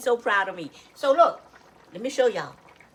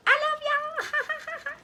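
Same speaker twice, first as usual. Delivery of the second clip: high sing-song; much slower than usual. Real, not acted. Affectionate sign-off to viewers.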